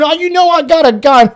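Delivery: high, loud imitated yell; retold yell. Robbed dealer yells after him, high and loud. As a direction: shout it after the fleeing thief. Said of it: "I hear him yelling".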